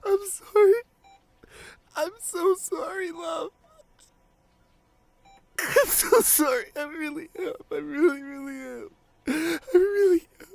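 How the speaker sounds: high, loud, sobbing sorry; crying apology. Acted. Partner sobbing an apology at the hospital bedside. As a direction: break down apologising through tears.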